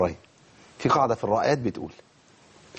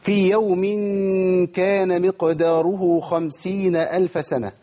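Quran recitation; 4 semitones higher, much slower than usual. Shown as a demonstration. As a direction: recite the verse slowly with full tajweed. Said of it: "خمسين مش خمسين". (تلاوة مجودة بطيئة)

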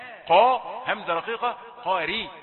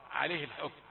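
normal talking voice

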